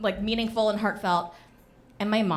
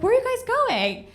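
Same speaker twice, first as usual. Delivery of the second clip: curious in-law; 14 semitones higher, 4 dB louder than usual. Retold or imitated. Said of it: "Patrick's mom is like".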